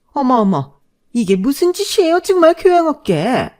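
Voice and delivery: high, loud scandalized shriek from the mother-in-law; shrill outraged mother-in-law. Acted. Mother-in-law doused with salt, shrieking in outrage. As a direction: clutch your pearls and shriek it.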